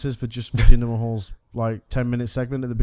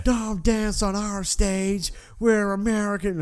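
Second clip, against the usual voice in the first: slow, high jeering American voice; mocking American fan; 7 semitones higher, slower than usual. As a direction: jeer like an angry American fan. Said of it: "you get the complete Americans".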